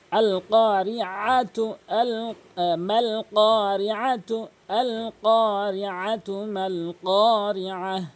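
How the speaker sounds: আল কারিআতু said slowly, each letter stretched; model Quranic reading. Shown as a demonstration. Teacher models slow careful reading of আল কারিআতু. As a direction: read আল কারিআতু with long even vowels, slowly. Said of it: "এভাবে উচ্চারণ করবেন".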